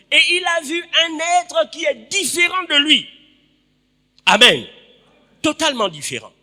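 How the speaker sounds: loud, high-pitched sermon delivery; full-force preaching. Real, not acted. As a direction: proclaim the story to the whole congregation. (prédication à pleine voix)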